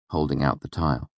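ordinary delivery

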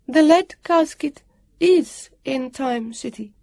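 high, slow, ringing declaration; strong and comforting. A narrator's reading. Guardian assuring them about the casket, strong and comforting. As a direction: declare it slowly, letting the voice ring out. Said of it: "Her voice rang out strong and comforting".

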